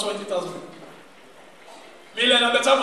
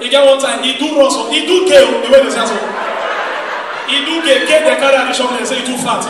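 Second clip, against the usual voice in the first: loud, driving roast of a friend; a loud ranting roast on stage; loud comic rant; 2 semitones higher, 11 dB louder than usual. Acted. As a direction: pile the jokes on loud and fast.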